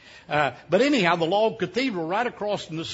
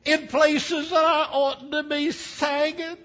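slow high falsetto complaint; Fanny Victoria's voice; 11 semitones higher, 3 dB louder, slower than usual. Retold or imitated. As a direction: stretch out the whine in a high old-wife voice. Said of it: "she said".

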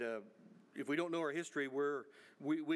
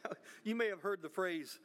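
usual speaking voice

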